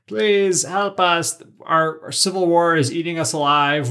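hammed-up cry for help, stretched out at first; mock desperate plea.

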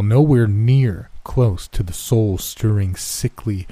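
ordinary delivery

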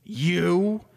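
single out the judge with a threat; accusing threat. Acted. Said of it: "he points at the judge".

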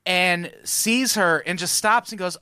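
normal talking voice

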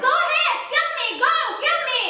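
high-pitched dare in a quarrel; desperate outburst. Acted. Wife challenges her husband in a fight, desperate outburst.

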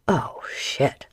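whispered dread; pirate dreading the fire ship; stunned whisper. Acted. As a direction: let it slip out under the breath.

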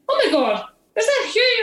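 retold gasp of surprise, pitch shooting up high; high, excited "is that Hugh?"; astonished recognition. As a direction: squeal it like you can't believe who's in the photo. Friend recognises man in photo.

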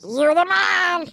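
quoting a cartoon coach, hammed-up cheer; Coach Steve voice. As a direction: boom it like a goofy coach. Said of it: "I've been just saying".

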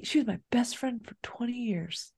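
hushed correction under the breath; correcting the quote quietly, whispered; whispered aside. Real, not acted. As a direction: slip it in as a whisper.

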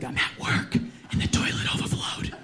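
slow hushed whisper. Retold or imitated. Mom at work reporting the toilet to her son, whispered. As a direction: whisper it like a confession. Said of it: "Why are you whispering?".

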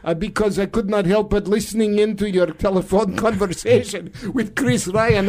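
mock Russian accent, chummy caller; Vladimir Putin. Russian leader phoning his friend Donald, mock Russian accent. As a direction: greet a comrade in a stagey Russian accent. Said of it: "I'm now talking in a Russian accent".

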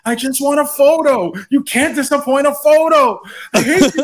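high-pitched hysterical meltdown impression; Troy. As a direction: wail it like a star-struck fan falling apart. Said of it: "Troy has to meet LeVar Burton".

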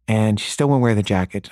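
plain reading voice

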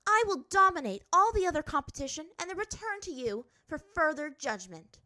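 high, loud, forceful vow; fierce princess, defiant. A narrator's reading. Azula vowing to beat the competition, defiant. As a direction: declare the challenge at full voice.

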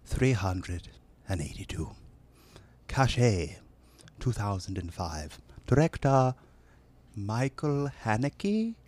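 put-on British accent. Knighted announcer reading a film blurb, British accent. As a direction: read it as a hushed British announcer, with long pauses. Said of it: "listen to a British accent".

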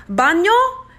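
high, rising Spanish "Baño?" asked hopefully; trying Spanish. Retold or imitated. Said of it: "So I try Spanish like an idiot".

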